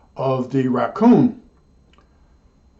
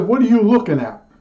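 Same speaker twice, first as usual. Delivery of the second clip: higher, punchier challenge at the stuffed raccoon; mock threat to the raccoon; 6 semitones higher than usual. Clerk glares at a stuffed raccoon, mock aggressive. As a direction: play the berserk kid, half joking. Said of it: "I sort of relived that".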